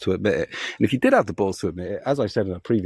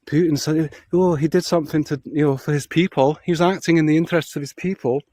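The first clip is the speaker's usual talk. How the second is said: pitched-up mocking quote of a rival; mocking Millennial Woes; 7 semitones higher than usual. Ranting vlogger mimics a rival defending Putin, mocking higher voice. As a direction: parrot his excuses in a higher, sneering voice. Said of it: "He says".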